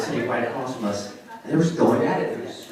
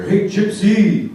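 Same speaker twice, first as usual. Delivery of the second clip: drawn-out louder schoolyard call; mock taunt; 10 semitones higher, 8 dB louder, much slower than usual. Middle school kids taunting him in the hallway, louder mock call. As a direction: shout it slowly down the hallway. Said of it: "I'd hear".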